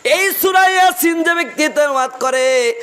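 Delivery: high, ringing sermon call; impassioned preaching. Real, not acted. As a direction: lift the voice high to proclaim it. Preaches at raised pitch.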